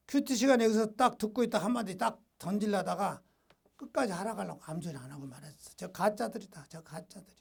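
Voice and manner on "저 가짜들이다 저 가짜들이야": low, hushed mutter to oneself; muttered inner thought. Retold or imitated. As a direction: let it drop away as a private grumble. Unspoken thought after saying nothing.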